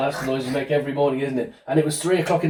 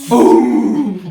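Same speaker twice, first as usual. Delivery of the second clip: booming; 2 semitones higher, 8 dB louder than usual. Shown as a demonstration. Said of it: "what noise might a volcano make".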